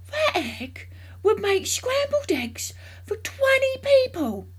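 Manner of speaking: high, slow, loud amazement; excited marvelling. A narrator's reading. Mister Cranky marvelling at the football-sized egg, excited. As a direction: stretch it out in loud, high wonder.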